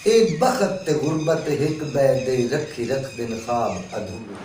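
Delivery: slow, measured Punjabi verse recitation; poetry recital. Acted. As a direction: recite softly, drawing out each phrase.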